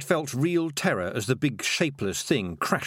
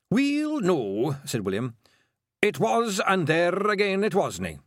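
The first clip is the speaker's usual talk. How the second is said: Scottish brogue; 7 semitones higher, 3 dB louder than usual. A narrator's reading. Feegle William voiced in Scots.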